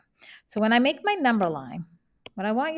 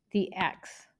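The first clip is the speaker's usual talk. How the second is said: ordinary talk, nothing marked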